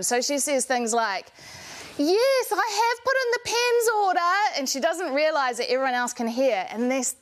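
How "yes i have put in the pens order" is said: high, loud office phone voice; loud phone talker. Acted. Office woman unaware everyone can hear, loud phone talker. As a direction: talk loud on the phone, pitched way up. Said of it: "she talks loud on the phone".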